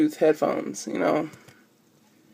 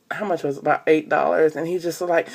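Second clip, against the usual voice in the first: ordinary delivery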